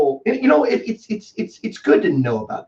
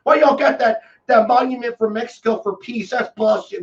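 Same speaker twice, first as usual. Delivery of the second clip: high, loud mock outrage, half shouted; mock protester; 8 semitones higher, 3 dB louder than usual. Mocking protesters with picket signs, high and loud. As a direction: ham up an outraged picketer yelling. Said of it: "have picket signs going".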